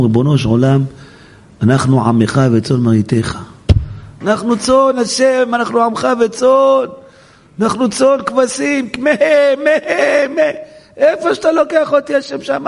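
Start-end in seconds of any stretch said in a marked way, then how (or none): 4.2-12.7 high, put-on voice of the flock; bleating sheep. Flock of sheep calling to God their shepherd, high bleating voice. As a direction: plead up high like a little sheep.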